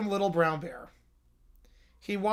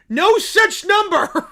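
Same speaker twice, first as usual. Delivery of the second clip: higher, louder, slow dim bear shout; 10 semitones higher, 8 dB louder, slower than usual. Acted. Little Brown Bear shouting about romance, slow and loud. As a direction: bellow it like a lovesick bear.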